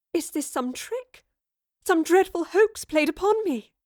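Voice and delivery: high, rising panic; alarmed. Acted. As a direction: voice climbs into alarm. Jane's alarmed outburst.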